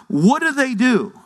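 ordinary talk

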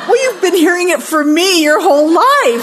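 high, loud, hammed-up protest; exasperated retort. Daughter telling mother she heard it from her, exasperated. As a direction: throw it back at full pitch, exaggerated.

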